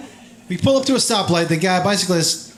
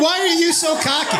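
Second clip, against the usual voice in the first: ordinary talk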